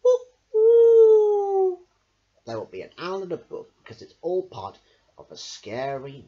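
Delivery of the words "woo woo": very high, loud hooting owl; high owl call. Shown as a demonstration. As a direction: hoot like an owl. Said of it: "when you hear another sound".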